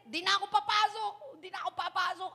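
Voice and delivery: put-on crying child refusing to go back to school; upset child. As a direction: wail it like a kid who won't go back.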